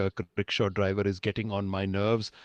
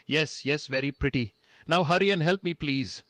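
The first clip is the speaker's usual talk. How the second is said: impatient hurry; 8 semitones higher than usual. Acted. Impatient with nosy man.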